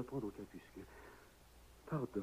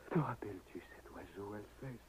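soft voiceless whisper; hushed whisper; 5 semitones lower, 8 dB quieter than usual. Acted. Widower whispers a memory to his dead wife. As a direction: whisper it tenderly to her.

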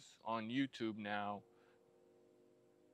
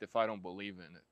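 ordinary talk

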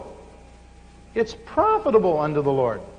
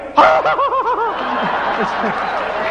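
joyful shout. Retold or imitated. Healed woman cries out.

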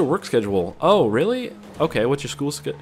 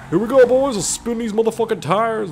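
hyped; 10 semitones higher than usual. Real, not acted. Hyping friends while driving.

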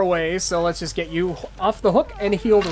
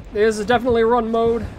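ordinary talk, nothing marked

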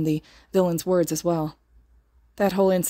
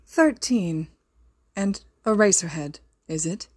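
lightly posh, elegant villain voice; a hint of refined menace; slower than usual. A narrator's reading. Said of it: "The voice sounded so posh and elegant".

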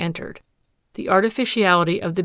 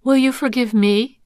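higher, louder, questioning rise on will; frankly repeating his plea; frank retort; 5 semitones higher, 6 dB louder than usual. Acted. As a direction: throw his plea back at him. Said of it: "she returned frankly".

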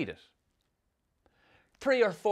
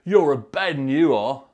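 louder, chuckling Cockney uncle; Cockney twang; 10 dB louder than usual. Retold or imitated. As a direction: tease him in a Cockney accent. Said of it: "in that Cockney twang of his".

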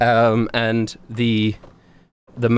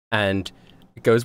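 ordinary delivery

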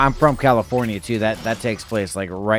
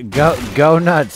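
loud dare; 4 semitones higher, 8 dB louder, slower than usual. Real, not acted. Taunting C J.

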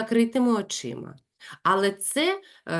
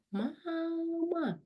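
«Мама!» very soft and high; quiet; 10 semitones higher, 9 dB quieter than usual. Shown as a demonstration. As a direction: breathe «Мама!» softly. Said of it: "Якщо я кажу: «Тихо»". (тихо, ледь чутно «Мама!»)

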